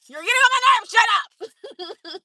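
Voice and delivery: hammed-up irritated yell; retold annoyed outburst. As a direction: act out being fed up, shouting. Acts out snapping at someone.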